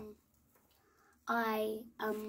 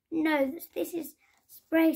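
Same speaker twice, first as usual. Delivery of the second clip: louder and higher answer; lively reply; 5 semitones higher, 6 dB louder than usual. A narrator's reading. Salmon answering Fox from the stream, brightly raised. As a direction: splash the answer back brightly.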